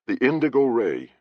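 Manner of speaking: a touch of stunned disbelief; naming a lost ship, understated shock. Acted. Captain spots his own ship, mild disbelief. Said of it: "Clift said in disbelief".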